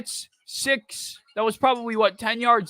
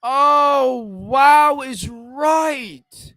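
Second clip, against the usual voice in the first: stunned shout; 4 semitones higher, 7 dB louder, much slower than usual. Real, not acted. Reacting to bad play.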